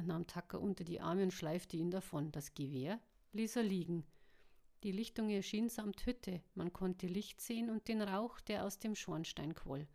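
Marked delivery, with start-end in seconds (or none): none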